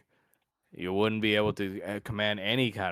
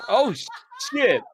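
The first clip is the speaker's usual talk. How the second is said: high, loud startled curse; 9 dB louder than usual. Real, not acted. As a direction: blurt it out in shock. GM reacts to handsome call.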